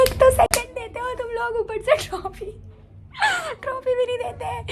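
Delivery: high, teary wail; whiny crying. Retold or imitated. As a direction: complain through tears like a wronged child. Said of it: "मैं इतना गंदा रोई उस hall में". (ऊँची आवाज़ में रोते हुए शिकायत)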